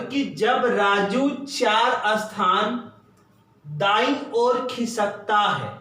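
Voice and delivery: slow, deliberate reading of the question. Shown as a demonstration. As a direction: lay each word out for students. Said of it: "कह रहा है यहां पर". (धीमी, ठहरकर प्रश्न पढ़ना)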